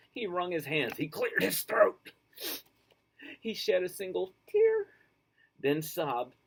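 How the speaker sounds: lively, high storybook read-aloud; reading to children. A narrator's reading. Teacher reading a picture book to children, animated. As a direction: read it big and bright for kids.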